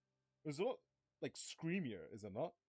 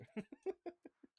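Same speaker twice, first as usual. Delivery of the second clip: burst of laughter. Real, not acted. Laughing at own slip.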